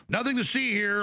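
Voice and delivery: brushing people off, "Nothing" leaned on; mock cop line. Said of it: "like the Naked Gun".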